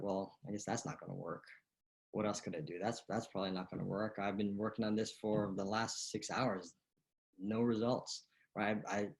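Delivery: quiet, defeated mumble; discouraged inner voice. Retold or imitated. Coach voices a golfer's self-talk in a slump. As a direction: sound worn down after hours of failing. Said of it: "we're conditioned to do".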